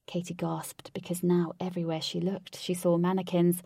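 usual speaking voice

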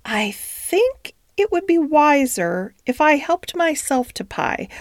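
high, slow private musing; thinking to herself. A narrator's reading. Duchess secretly deciding about the pie, musing to herself. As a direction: think it through slowly, half-whispered. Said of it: "thought the Duchess to herself".

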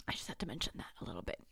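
quick hushed whisper, tossed off fast; whispered aside. Real, not acted.

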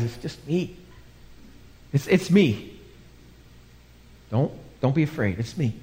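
soft, soothing acted voice; calm the frightened men quietly, with small hesitations; gentle reassurance. Jesus reassuring the frightened disciples.

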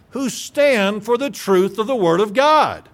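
ordinary talk

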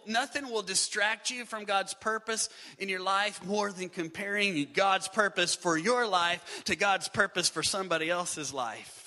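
normal talking voice

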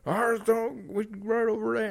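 high mock stranger voice. Voicing stranger, high pitch.

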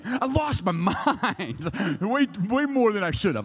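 high, loud, drawn-out mock outrage; losing it. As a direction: stretch it out loudly in comic exasperation. Retelling his outrage.

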